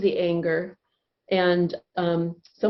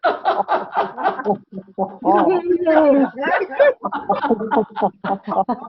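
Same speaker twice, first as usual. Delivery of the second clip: higher, louder laughing; applause laughter; 6 semitones higher, 4 dB louder than usual. Shown as a demonstration. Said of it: "round of applause".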